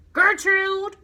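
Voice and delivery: high, loud parrot squawk; parrot scream. A narrator's reading. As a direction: shriek it like a bird. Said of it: "the parrot screamed again".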